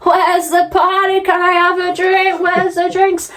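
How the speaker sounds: imitating a drunk intruder wanting drinks; drunk guy. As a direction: barge in chirpy and tipsy. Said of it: "he's like".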